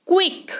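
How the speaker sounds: क्विक opening on a half k run into v; kw sound. Shown as a demonstration. Teacher showing Q's sound in क्विक, kw blended at the start. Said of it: "आधा क और व के तौर पर".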